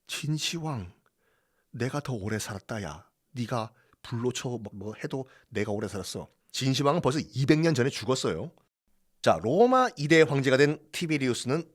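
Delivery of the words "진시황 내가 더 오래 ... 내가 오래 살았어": quiet taunt to Qin Shi Huang. Acted.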